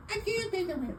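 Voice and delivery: high, soft falsetto plea; distressed damsel. Pretend. Melodrama damsel bit.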